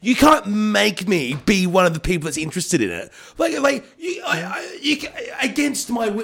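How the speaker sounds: high-pitched, stammering outrage running out of words; spluttering rant. Retold or imitated. Host refusing to share the interest, spluttering rant. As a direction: splutter helplessly in a high protesting voice.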